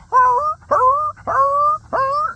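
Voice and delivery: high-pitched, loud dog yelps; repeated piercing mock howls; imitated dog howls. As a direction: yelp like a dog in agony. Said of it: "sounds like the dog is freaking dying".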